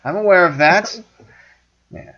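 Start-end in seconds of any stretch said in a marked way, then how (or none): 0.0-1.0 high, loud, fed-up insistence; exasperated shout. Retold or imitated. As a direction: snap it at the whole crew. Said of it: "he turns to the rest of the crew".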